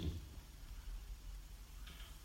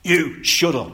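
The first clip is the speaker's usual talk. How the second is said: loud, high, blunt "You shut up"; harsh shut up; 8 semitones higher, 11 dB louder, slower than usual. Retold or imitated. Jesus silencing the demon, loud and harsh. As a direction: bark "You shut up" in anger. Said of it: "That "be quiet" was".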